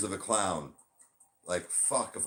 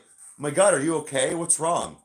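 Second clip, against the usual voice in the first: louder, fussing worried friend imitation; mock alarmed concern; 3 semitones higher, 6 dB louder than usual. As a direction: imitate someone overreacting with concern. Acting out worried people checking.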